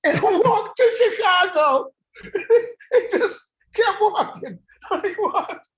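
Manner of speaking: high, slow words through laughter; can barely speak for laughing; laughing hard. Real, not acted. Cracking up at story.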